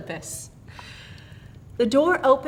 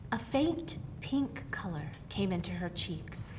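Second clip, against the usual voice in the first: plain reading voice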